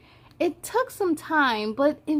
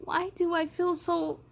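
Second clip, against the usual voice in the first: put-on scared girl; 3 semitones higher, 5 dB quieter, slower than usual. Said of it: "certain feelings are getting in her way".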